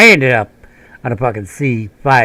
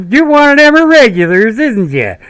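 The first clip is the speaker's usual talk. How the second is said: teasing sergeant; 12 semitones higher, 6 dB louder than usual. Retold or imitated. Sergeant ribbing the Pennsylvania-born soldier, high joking voice. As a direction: rib him like a good-old-boy sergeant.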